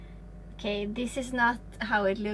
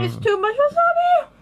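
high loud yelp at the wasabi burn; shouting; 10 dB louder than usual. Real, not acted. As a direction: cry out as the heat hits. Wasabi burn while eating sushi.

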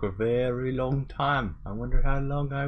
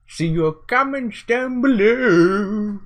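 higher, louder, slowed sign-off; slow, bright outro call; upbeat sign-off; 10 semitones higher, 6 dB louder, slower than usual. Real, not acted. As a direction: sell the outro to viewers. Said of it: "outro call".

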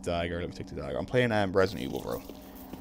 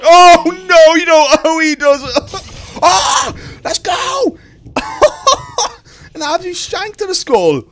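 very high, very loud hysterical cheering with laughs; ecstatic yelling; 16 dB louder than usual. Real, not acted. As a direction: scream your joy at a miracle win. Wild celebration after lucky kill.

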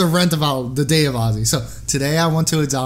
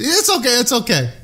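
put-on high, insistent Russian-accented reassurance; Russian grandmother; 6 semitones higher than usual. Nurse covering up her slip, high Russian-accented reassurance. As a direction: hush the patient as a Russian grandma. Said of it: "She said".

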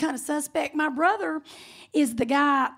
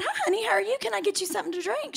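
lilting, higher, softer server voice; friendly bartender greeting; 6 semitones higher, 5 dB quieter, faster than usual. Retold or imitated. Bartender greets the woman at her bar, chirpy greeting. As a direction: play a chirpy welcome to a customer.

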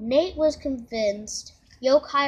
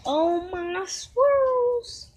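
much higher, slow drawn-out lament; mournful wail; 8 semitones higher, 4 dB louder, much slower than usual. Acted. Komasan mourns his ice cream swirls, high and slow. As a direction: wail over lost treats.

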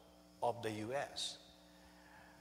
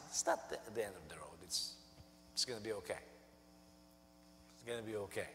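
much softer, gentle comfort; reassuring; 3 semitones lower, 10 dB quieter, faster than usual. Real, not acted. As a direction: soothe them that it will be fine. Softly reassuring the congregation.

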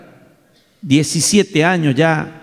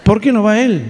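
indignant question; 5 semitones higher than usual. Retold or imitated. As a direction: push back hard at the daughter. Dad demanding boyfriend go.

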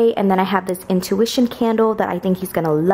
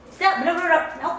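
firm dog commands; 9 semitones higher than usual. Real, not acted. Dogs break the stay.

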